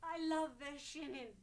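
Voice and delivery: loud. Acted. Bursting out her confession.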